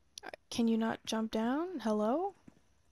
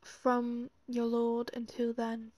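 put-on British accent. Player reading the game's note aloud, put-on British accent. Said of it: "I said that in the British accent".

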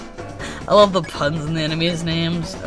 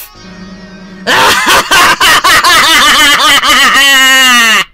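loud menacing cackle; big evil villain cackle; evil laugh; 7 semitones higher, 11 dB louder than usual. Pretend.

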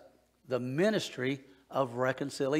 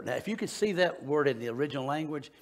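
ordinary talk

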